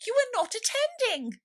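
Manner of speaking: severe mouse. A narrator's reading. Mouse scolding Alice, high severe squeak. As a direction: scold like a prim little mouse. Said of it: "said the mouse to Alice severely".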